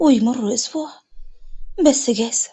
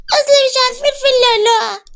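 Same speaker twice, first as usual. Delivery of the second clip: put-on high, fast childish wail; crying child; 11 semitones higher, 4 dB louder, faster than usual. A narrator's reading. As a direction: blurt it out as a sobbing kid. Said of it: "وإخواتها كلهم بيعيطوا". (بعياط طفل)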